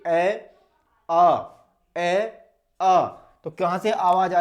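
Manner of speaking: long, loud open vowels ए and आ sounded out; vowel demo. Shown as a demonstration. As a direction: hold each vowel ए, आ like a teacher. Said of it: "देखिए".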